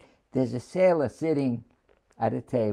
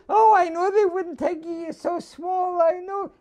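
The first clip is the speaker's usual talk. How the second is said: much higher, louder mother's voice, partly shouted; tearful mother; 5 dB louder than usual. Retold or imitated. Mother tells her son he is too small: high, shouted, tearful. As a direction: play the upset mother sure they won't take him. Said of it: "My mother is still crying".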